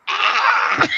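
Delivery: high, sudden mock scream; a high startled ah; frightened yelp. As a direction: yelp as if grabbed.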